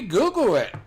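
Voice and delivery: high, loud repeated call. Real, not acted. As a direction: call it out again, insisting. Repeats the urging to Cody.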